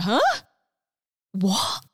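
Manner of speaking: retold startled question, rising; shocked reaction. Sister hearing her brother's big news, shocked. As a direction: act out being taken aback. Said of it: "why are you so shocked".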